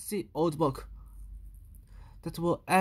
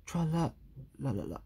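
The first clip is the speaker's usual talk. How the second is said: lower, soft fading sing; half-hearted tra-la; 5 semitones lower, 7 dB quieter than usual. Acted. Louis singing sadly, giving up. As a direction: sing it limply.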